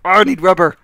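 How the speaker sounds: acted outburst. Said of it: "I'll go back and be like".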